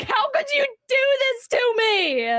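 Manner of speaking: hammed-up indignant plea; aggrieved accusation. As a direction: wail it like a wronged victim. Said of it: "just giving Mildred a whole".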